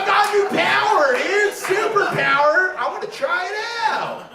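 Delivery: high, loud, hyped-up yell; excited shout. Retold or imitated. Comic reenacting his newfound confidence, high excited shout. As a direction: shout it like you just got superpowers.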